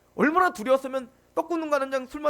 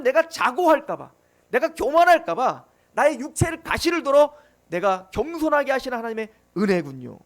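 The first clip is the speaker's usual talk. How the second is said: forceful preaching; 6 dB louder than usual. Acted.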